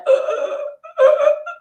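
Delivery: horrified gasp. Retold or imitated. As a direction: gasp in mock horror at the spit. Aunt licks finger on her lip.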